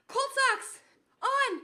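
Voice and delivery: loud, high and strained from the cold; strained triumphant shout. Real, not acted. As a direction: shout it high through the icy shock. Announces through icy shock.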